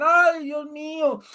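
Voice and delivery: high, loud, drawn-out mock wail; hammed-up crying out to God; emotional worshippers. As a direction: wail like an overwrought congregant, vowels stretched. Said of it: "se llenan de emoción, lloran".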